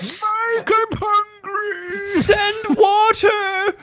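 high, slow, far-off pleading; muffled closet cries. Pretend. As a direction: beg from behind a locked door. Voicing Hazel locked in closet.

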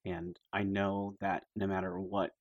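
soft, slow, earnest encouragement; intimate pep talk. Acted. Friend gives Phaedra emotional support before fight, soft slow pep talk. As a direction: pump her up quietly, word by word. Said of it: "pump her up a lot".

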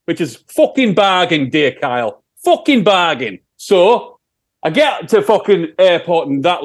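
put-on Sheffield accent. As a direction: answer as a broad Sheffield rock singer. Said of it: "it's my Sheffield accent".

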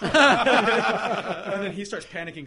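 laughing loudly through the words, slow; telling it while cracking up; real laughter breaking into the story. Real, not acted.

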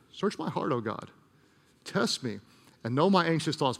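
hushed, low prayer voice; quiet prayer. Shown as a demonstration. Pastor leading the church in a quiet prayer. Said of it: "pray this prayer".

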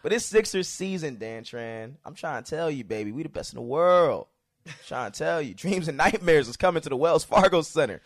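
heroic rallying shout; loud boastful hometown hype; wrestling promo. Pretend. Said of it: "cut a wrestling promo".

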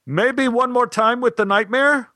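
high, sustained call to the stage; mock yell held up high. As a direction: imitate a director calling out a suggestion. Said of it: "Stein yelled".

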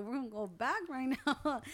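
loud and high, laughing through it; fired up, half laughing; hyped retold yell. Retold brother hyped to go back.

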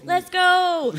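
loud, high rallying yell; big echoed cheer; hype shout. Acted. Echoes a hype shout, then laughs.

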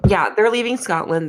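normal talking voice

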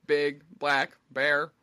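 slow robotic monotone; robot voice, evenly spaced words. Pretend. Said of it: "definitely sounded like a robot".

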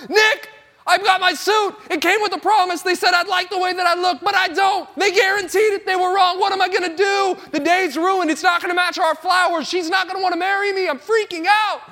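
high, frantic pleading about the suit; freaking out. Retold or imitated. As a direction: spill it all out to a friend in a panic, half mocking yourself. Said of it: "and I'm freaking out".

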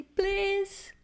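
high, drawn-out mock cry for help; imitated high plea; retold desperate cry. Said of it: "eu só gritava assim".

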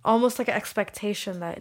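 ordinary delivery

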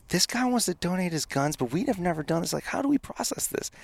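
high, soft, baffled cop voice; quiet high-pitched imitation of nervous officers; mock confused officers. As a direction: lift way up and go soft like lost rookies. Officers asking the chief, baffled.

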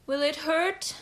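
nervous gulp. A narrator's reading. Boy asking his healer about pain, nervously. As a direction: ask timidly, voice rising at the end. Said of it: "the boy gulped".

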